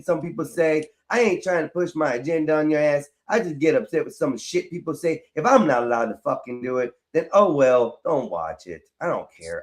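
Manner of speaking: drops to a low, soft grumble; low, soft, bitter grumbling; low bitter mutter. Real, not acted. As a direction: mutter it resentfully, pulling away. Upset speaker muttering in argument.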